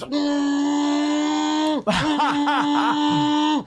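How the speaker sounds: long high droning hum; imitated drone. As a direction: hold a high, steady "mm" like a machine buzzing. Imitates droning noise on waking.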